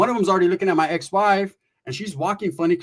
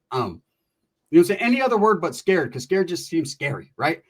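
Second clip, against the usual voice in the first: normal talking voice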